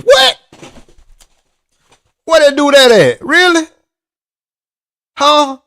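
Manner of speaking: can't believe what he's hearing; shocked that the friend won anyway; stunned disbelief. Real, not acted. Disbelief at the friend's race win.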